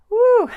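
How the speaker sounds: high, loud "woo"; loud whoop at getting through it; celebratory whoop. Real, not acted. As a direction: let out a high, ringing whoop. Relieved cheer on finishing long video.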